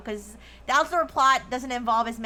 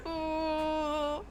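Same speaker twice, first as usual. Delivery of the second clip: mock whimper; 3 semitones higher, 5 dB quieter than usual. Fake cry after the denial.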